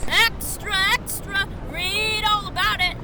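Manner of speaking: put-on high, slow street cry of a paper boy; high-pitched newsboy hawking, stretching out all; newsboy calling. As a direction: sing out the headlines to passers-by.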